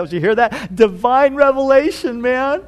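bragging to the others, pitch climbing; playful, self-impressed aside; Peter. Acted. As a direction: show off, drawing out the boast with a rising lilt. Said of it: "I can just see Peter looking the other side".

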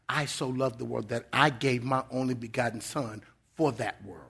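put-on a quiet loving correction to a frustrated child; gentle voice of the Lord. The Lord reminding Tony of his love, soft and tender. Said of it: "the Lord taps me on the shoulder and said".

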